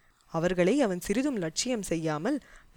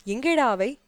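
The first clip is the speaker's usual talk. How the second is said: sharp demand; 6 semitones higher, 5 dB louder than usual. A narrator's reading. Commander demands clothes from guards.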